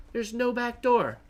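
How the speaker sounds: There's no back door. pitched high, falling on "door"; realizing he is locked out; dismayed answer. Real, not acted. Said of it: "And there's no back door?".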